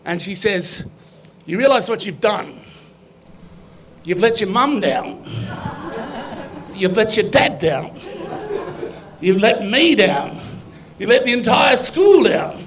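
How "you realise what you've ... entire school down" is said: disappointed teacher hammering every line home; scolding teacher. Acted. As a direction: lay on each disappointment heavily. Inflatable teacher scolds the boy.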